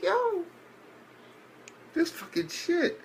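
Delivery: very high-pitched stunned "yo"; incredulous reaction. Retold or imitated. Narrator recounting the student council president's appearance, incredulous. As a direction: squeak it in mock disbelief.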